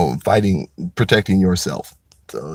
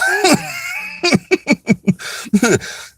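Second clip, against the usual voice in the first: high laugh. Real, not acted. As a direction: burst out laughing at the story. Laughs at the exchange.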